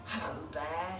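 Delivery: lightly hissed snake greeting; a hint of snake hiss. A narrator's reading. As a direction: draw it out like a snake. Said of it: "she hissed".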